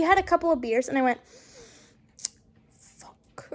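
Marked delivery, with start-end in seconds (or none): 2.8-3.5 barely audible whispered curse; whispered dismay. Retold or imitated. Daughter hearing mum had beers, whispered dismay. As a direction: swear under the breath in mock dread.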